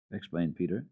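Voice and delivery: ordinary delivery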